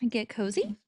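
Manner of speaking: normal talking voice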